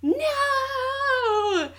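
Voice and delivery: long, high, wailing mock scream; yell. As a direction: drag it out in a dramatic high-pitched cry. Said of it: "I think she yells".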